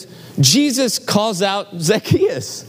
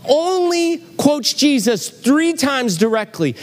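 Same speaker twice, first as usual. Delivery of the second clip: incredulous; 9 semitones higher than usual. Real, not acted. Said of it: "So I'm like".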